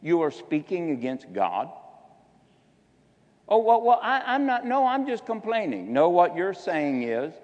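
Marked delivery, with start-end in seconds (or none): 3.5-5.9 high, laughing excuse-making whine; imitating a church member caught complaining; mock complainer. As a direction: play the sheepish grumbler brushing it off. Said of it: "People that murmur and complain".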